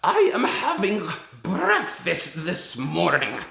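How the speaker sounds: put-on high, loud, slow screech with a Slavic accent; a dad screeching a Slavic-accented witch; Grand High Witch. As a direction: ham up the witch with rolled R's. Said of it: "here's what it sounds like when you read The Grand High Witch".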